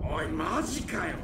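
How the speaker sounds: low, hushed disbelief; can't believe his eyes; stunned disbelief. Acted. Stunned to see Dante alive.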